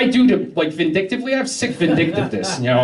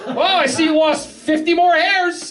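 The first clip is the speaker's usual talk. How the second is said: mock barber; 8 semitones higher, slower than usual. Barber's mock congratulations voiced.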